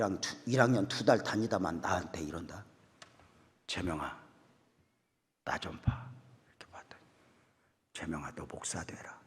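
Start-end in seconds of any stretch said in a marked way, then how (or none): 3.7-9.2 hushed, breathy call in the dark; late-night whisper. Retold or imitated. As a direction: whisper so the others won't wake. (한밤중에 속삭이는 목사님 말투)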